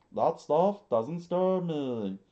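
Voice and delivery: put-on high defiant boy, restarted take; loud high boy voice. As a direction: insist like a stubborn boy begging to fight. Boy Kappa boasts.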